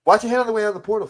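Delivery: rapid high announcer voice; fast, high sign-off catchphrase. Acted.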